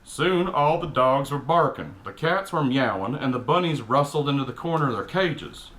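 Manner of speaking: ordinary talk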